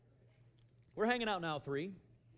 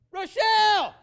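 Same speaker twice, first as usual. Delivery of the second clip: very high, huge holler; full-volume yell; 22 dB louder, slower than usual. Retold or imitated. Husband calling his missing wife through Walmart, full-throated yell. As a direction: bellow a name across a whole store. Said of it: "Oh, I got vocal".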